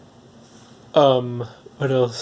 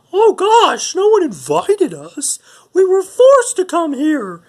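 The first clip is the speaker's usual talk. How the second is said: put-on squeaky falsetto puppet boy, drawn out; Pinocchio; 5 dB louder, slower than usual. Puppet explains they were forced to come, squeaky falsetto. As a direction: plead very high, louder on the outbursts. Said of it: "And Pinocchio goes".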